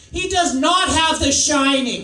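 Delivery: mother's big, loud objection; loud indignant mother. Retold or imitated. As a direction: protest like an outraged mother. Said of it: "She sounds just like that".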